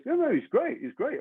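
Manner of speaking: high, quick eager reassurance; overselling a client she barely knows; mock eager agent. Agent vouching to casting director, mock eager.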